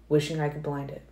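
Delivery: ordinary delivery, nothing marked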